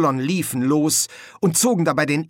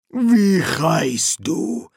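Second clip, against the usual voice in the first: very slow, high foreign interrogator; guard leader; 6 semitones higher, 4 dB louder, much slower than usual. Acted. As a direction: stretch out each word of the demand.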